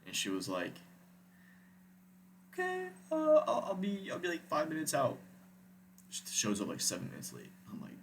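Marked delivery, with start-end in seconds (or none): 2.5-5.2 mock her reply in a stretched high pitch; imitating the date. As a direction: start high and drag out the okay. Voicing his date's reply.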